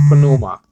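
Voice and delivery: "pneuma" slowly as puh-NOO-ma; "pneuma" with a small vowel between P and N; pronounced P. Shown as a demonstration. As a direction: let the P be heard in "pneuma". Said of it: "it's better pronounced like".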